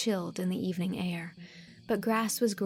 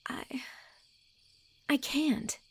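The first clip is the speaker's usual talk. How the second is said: halting, higher and miserable; 7 semitones higher than usual. Acted. Anne refusing Gilbert, miserable. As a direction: force the refusal out. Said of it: "said Anne miserably".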